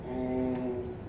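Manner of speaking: ordinary talk, nothing marked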